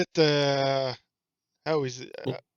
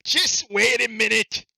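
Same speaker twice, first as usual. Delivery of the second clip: loud, high, stretched-out shout; calling out; 8 semitones higher, 8 dB louder, slower than usual. Acted. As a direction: holler it slowly to the gnome. Player calls to struggling gnome.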